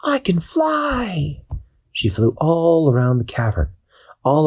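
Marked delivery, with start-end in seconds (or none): 0.0-1.4 much higher, slow, amazed cry; joyful Dashi. Acted. Dashi lifting off the ground, high amazed cry. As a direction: marvel at flying.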